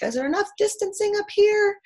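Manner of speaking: high, loud sing-song question; mock polite asking. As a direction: ask with exaggerated politeness. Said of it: "I'm like".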